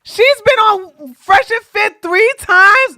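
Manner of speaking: high, loud, slow incredulous question; astonished. Real, not acted. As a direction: drag it out in disbelief. Amazed at her third appearance.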